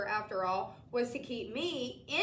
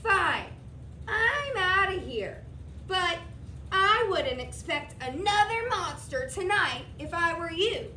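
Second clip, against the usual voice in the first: higher, louder huffy monster; Mac storming off; 6 semitones higher, 6 dB louder than usual. A narrator's reading. Mac storms off warning the boy. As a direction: leave in a huff with a parting threat.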